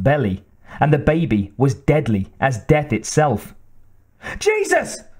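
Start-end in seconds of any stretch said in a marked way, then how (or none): 4.4-5.0 high-pitched cry of alarm; startled cry. A narrator's reading. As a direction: cry out in shock, pitch shooting up. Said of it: "cried Daniels".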